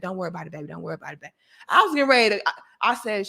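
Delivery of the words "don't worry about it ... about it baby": low, soft, hurried reassurance; hushed, motherly mock soothing; soothing manager. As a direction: imitate an older manager comforting a worker. Manager soothing the employee after refund.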